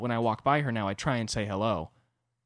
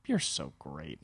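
put-on soft sweet girlfriend; 9 semitones lower, 7 dB quieter, slower than usual. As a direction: lower and softer, tender. Girlfriend voiced sweetly at waking.

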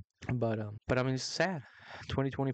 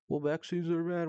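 mocking weird-voice impression, pitched up; higher, altered mocking voice; R F K Junior; 5 semitones higher than usual. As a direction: put on a weird voice to mock him. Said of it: "with his weird ass voice".

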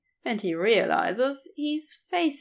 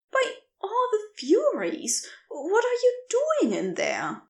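higher, gentle voice full of amazement; astonished stranger; 10 semitones higher than usual. Acted. The stranger peers down the well at Crowley, higher and astonished. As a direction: exclaim in kindly disbelief.

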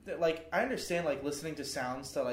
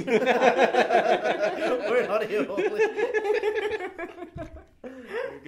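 laughing; 10 semitones higher, 9 dB louder, much slower than usual. Real, not acted. Laughing through words.

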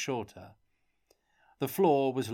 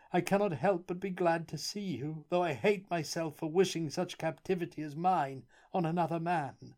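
miserable, pleading plea pitched much higher; dry sobbing; 8 semitones higher than usual. A narrator's reading. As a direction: beg forgiveness through dry sobs. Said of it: "sob rather dryly".

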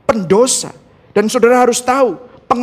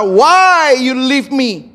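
drawn-out, raised cry of despair; acted wail of hopelessness; hopeless cry; 5 semitones higher, 4 dB louder, much slower than usual. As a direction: cry out slowly, utterly abandoned. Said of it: "Ini teriakan hopelessness".

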